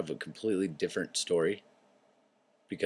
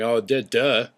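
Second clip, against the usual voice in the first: mock duh; 4 semitones higher, 9 dB louder, slower than usual. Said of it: "plenty of you out there going".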